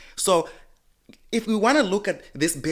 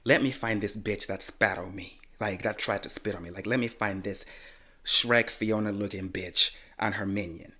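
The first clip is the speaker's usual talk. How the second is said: softer, lower muttered thought; inner thought; 5 semitones lower, 6 dB quieter than usual. Retold or imitated. Hunting the woman in her mind, low seething mutter. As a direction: seethe quietly inside. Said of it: "In my mind, I'm thinking".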